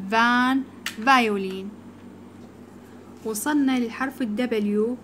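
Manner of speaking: ordinary delivery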